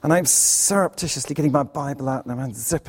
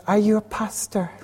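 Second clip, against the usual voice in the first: put-on higher-pitched old Scottish lady; imitated old lady's accent; 7 semitones higher than usual. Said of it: "forgive my accent".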